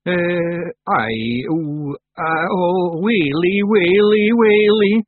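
panicked moan. A narrator's reading. Luckless Willie cornered by the witch, moaning. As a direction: moan helplessly, rising to a loud wail. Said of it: "moaned Daft Willie".

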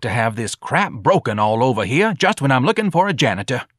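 irritated bar owner. Acted. Bar owner fuming over the mess, irritated. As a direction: gripe with exasperated punch on the words.

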